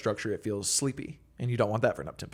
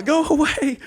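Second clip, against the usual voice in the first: much higher, louder mock shooing; mock retort; 7 dB louder, slower than usual. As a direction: squeal it like a playful brush-off. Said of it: "I'm like".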